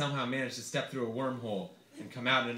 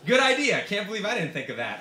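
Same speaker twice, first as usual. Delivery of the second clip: much higher and louder, gushing agreement; mock enthusiasm; 7 semitones higher, 8 dB louder than usual. Author answering his dealer friend, hammed-up excitement. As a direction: overplay the eager yes. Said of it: "I replied".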